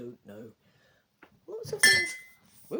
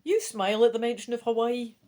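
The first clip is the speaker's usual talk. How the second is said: plain reading voice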